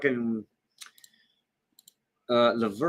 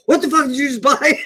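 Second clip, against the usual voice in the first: much higher, louder, faster mock outrage, laughing through it; imitating a furious husband; 4 dB louder, faster than usual. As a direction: play the enraged husband yelling at his wife, half laughing. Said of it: "he was fucking furious".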